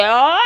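imitated aggressive singer's cry. Preacher imitating a Brazilian woman singer, aggressive "ah". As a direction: belt out a long, harsh demonstration "ah". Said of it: "una voz agresiva de".

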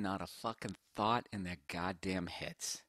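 normal talking voice

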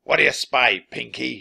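commanding villain shout; pirate captain shouting. A narrator's reading. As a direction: shout up to a crewman. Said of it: "shouted Captain Bones".

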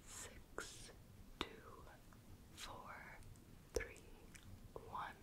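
faint whisper right into the ear; barely audible numbers, hushed into the ear. Shown as a demonstration. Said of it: "whispering a series of numbers in your ear".

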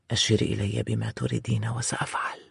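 whispering. Acted. Smitten hero whispers devotion.

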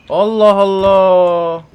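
stretched, frustrated outburst. Real, not acted. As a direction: drag it out, building irritation. Still no gasoline anywhere.

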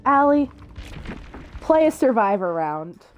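loud, drawn-out demand; calling out. Real, not acted. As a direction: call her name loudly across the room. Calling out to her friend.

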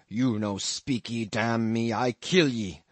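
slow, gruff pidgin threat; slow menacing threat. A narrator's reading. Queequeg threatening the stranger in his bed, slow and menacing. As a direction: threaten him word by word.

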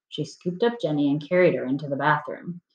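ordinary delivery, nothing marked

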